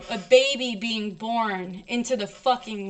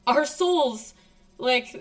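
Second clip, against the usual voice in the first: normal talking voice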